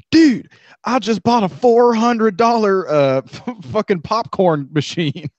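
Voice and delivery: high, slow, excited bragging buddy; hammed-up excited friend; imitating Dalton. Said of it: "but then he'd be like".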